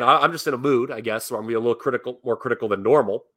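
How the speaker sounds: ordinary delivery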